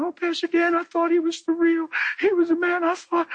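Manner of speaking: high, hammed-up weeping church lady; mock the gossip with high whining; mock wailing churchgoer. Churchgoers lamenting over Pastor Dan, mock-weeping high. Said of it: "They'd have been crying".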